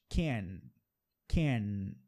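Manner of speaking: tongue up for the N. Shown as a demonstration. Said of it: "a língua tá no céu da boca".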